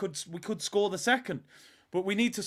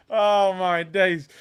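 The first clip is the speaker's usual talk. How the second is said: ordinary talk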